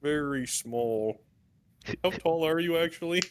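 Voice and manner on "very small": low, slow, soft simple voice; slow, quiet marvel, pitched low; Lunk. Acted. Lunk remarks on tiny squadmate.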